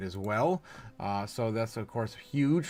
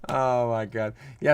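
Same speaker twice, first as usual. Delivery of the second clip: ordinary delivery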